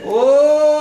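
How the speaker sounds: mock southern motel clerk voice, barely holding back a laugh; southern accent. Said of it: "So I try to use a southern ac-, accent".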